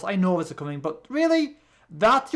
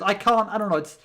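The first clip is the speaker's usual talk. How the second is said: ordinary talk, nothing marked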